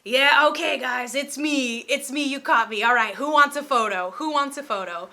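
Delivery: loud, showy celebrity announcing himself; hammed-up booming invitation to the room; Mister T. Mister T standing up to address the restaurant, loudly. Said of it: "He stands up and just goes".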